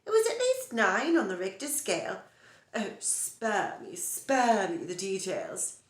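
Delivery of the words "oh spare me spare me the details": slow, drawn-out mock boredom from the friend; weary Jane. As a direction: drag each word like a bored prude. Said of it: "Jane does".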